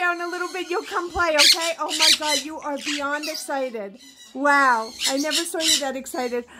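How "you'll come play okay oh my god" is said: usual speaking voice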